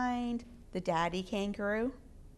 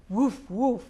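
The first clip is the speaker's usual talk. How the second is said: puppy barks; 5 dB louder than usual. Acted. Puppy racing to the hilltop.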